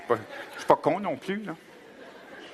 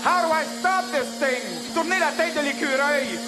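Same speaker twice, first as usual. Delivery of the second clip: higher, slower panicked officer; higher slow flustered officer; startled officer; 7 semitones higher, slower than usual. Acted. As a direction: fumble with a buzzing gadget.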